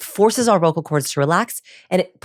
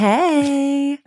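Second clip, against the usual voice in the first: put-on breezy cool girl; 8 semitones higher, much slower than usual. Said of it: "if for you, that is".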